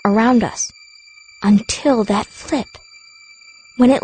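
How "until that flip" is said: ordinary delivery, nothing marked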